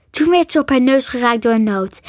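ordinary delivery

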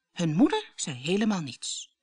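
plain reading voice

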